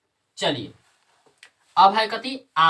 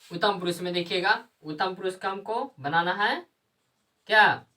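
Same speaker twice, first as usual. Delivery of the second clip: ordinary talk, nothing marked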